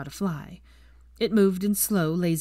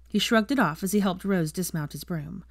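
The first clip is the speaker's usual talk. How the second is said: plain reading voice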